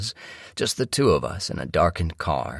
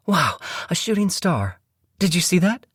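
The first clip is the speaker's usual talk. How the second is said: very high, delighted cry of wonder; excited wife; 13 semitones higher than usual. Acted. Wife spotting a shooting star, excited. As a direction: pitch it way up, thrilled.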